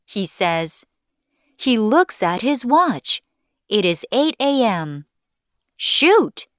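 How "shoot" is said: high, sudden outburst of annoyance; frustrated exclamation. A narrator's reading. Man seeing he is late, frustrated. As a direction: blurt it out high, vexed.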